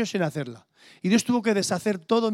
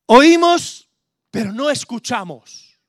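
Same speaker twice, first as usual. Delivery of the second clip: emphatic preaching; 2 semitones higher, 13 dB louder, slower than usual. Real, not acted. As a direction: hammer home the point to the congregation. Preacher hammering home his point.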